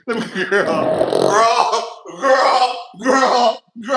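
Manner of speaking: laughing exclamation. Real, not acted. Mock outrage at a viewer, cracking up.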